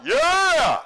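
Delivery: high, loud cheering yell; fan's shout. Shown as a demonstration. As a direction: shout it like a fan at a concert.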